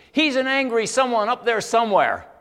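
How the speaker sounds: loud, high retort, imitating an angry woman; quick angry outburst. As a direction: fire it back instantly, at full voice. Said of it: "she goes like this. It was so quick".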